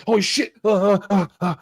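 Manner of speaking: mock winded gasps after the words; badly winded, put on for show; acted out of breath. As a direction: pretend to be winded and panting. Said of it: "He'd be fucking out of breath".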